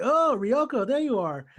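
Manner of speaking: mock-surprised, high and loud opening; surprised greeting. Tenchi comes out of nowhere and finds Ryoko, surprised greeting.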